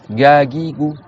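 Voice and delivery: gagigou with g at the back of the tongue; evenly drilled g in gagigou; g behind the k. Shown as a demonstration. Teacher moves behind ka to ga, demonstrating the g. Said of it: "Derrière le ka".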